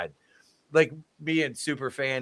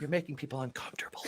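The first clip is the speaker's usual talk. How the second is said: fast, softer polite hush; mock whisper; 4 semitones higher, 10 dB quieter, much faster than usual. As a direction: whisper it like a prim usher. Mock polite security removing them.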